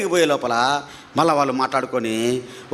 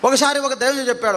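ordinary talk, nothing marked

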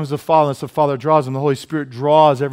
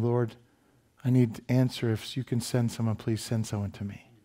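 quiet prayer; 3 semitones lower, 9 dB quieter than usual. Retold or imitated. Boxer quoting his quiet prayer.

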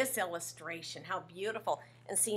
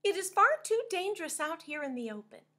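urgent warning; 9 semitones higher, 5 dB louder, faster than usual. Acted. Striped fish warning of danger.

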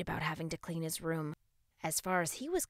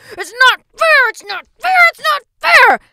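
loud high whining shout; tantrum yelling; 25 dB louder than usual. A narrator's reading. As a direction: throw a full tantrum. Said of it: "working on his tantrum".

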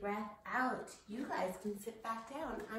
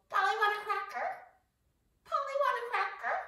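very high, louder squawky parrot; 14 semitones higher, 8 dB louder, faster than usual. Pretend. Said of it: "use your best parrot voice".

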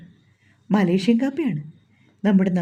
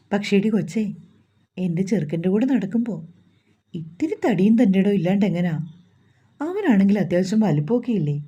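usual speaking voice

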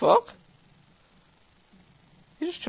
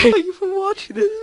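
high, quick, mock-tearful sign-off; fake crying; 10 semitones higher than usual. Gamer thanking viewers after the crash, mock crying. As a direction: pretend to sob through the thanks. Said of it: "I'm not actually crying".

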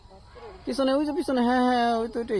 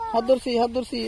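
usual speaking voice

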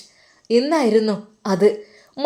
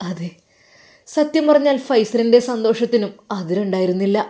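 usual speaking voice

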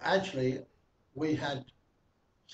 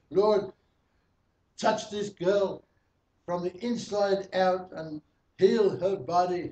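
fervent prayer; 3 semitones higher, 3 dB louder than usual. Retold or imitated. Praying over a mute girl at the meeting, loud and fervent. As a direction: pray it out with full force, commanding Satan. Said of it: "So I'm saying".

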